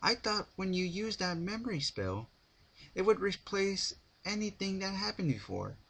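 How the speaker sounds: put-on high, eager pony girl; Pinkie voice. A narrator's reading. Pinkie's voice continues.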